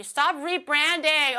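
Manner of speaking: slow, loud, drawn-out scold; exaggerated loud scold; mock scolding. Real, not acted. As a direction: stretch it out like a telling-off. Scolding chat for misreading names.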